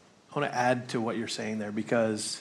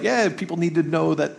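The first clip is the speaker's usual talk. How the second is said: breezy generic cheer; 5 semitones higher, 5 dB louder than usual. Retold or imitated. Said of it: "It's not just like".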